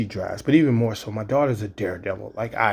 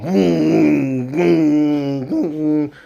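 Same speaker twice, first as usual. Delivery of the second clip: imitated booms; 4 semitones higher, 5 dB louder, much slower than usual. Daughter speeding, car noise.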